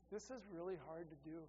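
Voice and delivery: soft and quick, only a hint of tears; tearful son's words. Pastor retelling his son Kyle's apology, lightly tearful. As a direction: retell the son's teary admission gently. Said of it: "tears in his eyes".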